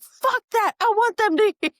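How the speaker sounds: high, loud defiant shout breaking into a laugh; defiant retold yell. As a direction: yell it so the mics catch it, laughing. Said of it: "I was like".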